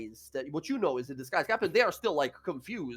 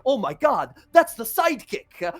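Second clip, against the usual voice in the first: high, loud, urgent appeal; amazed guard; 8 semitones higher, 6 dB louder than usual. Acted. Guards spot the sidekick amid the attack, excitedly alarmed. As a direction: burst out in surprise, then plead for help.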